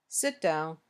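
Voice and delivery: low, soft, quick "Sit down"; acted low command, quickly done; low, short request. Shown as a demonstration. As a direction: keep it low and brief. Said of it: "each one shows a different feeling or emotion".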